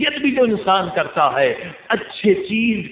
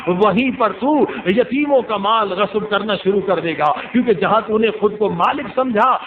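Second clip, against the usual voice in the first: raised, high impassioned sermon cadence, driving each line home; preaching at full force; 4 semitones higher than usual. Acted. Preacher rousing the gathering about pride, impassioned preaching. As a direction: preach it like the climax of a majlis.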